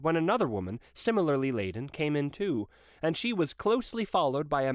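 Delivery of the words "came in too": ordinary delivery, nothing marked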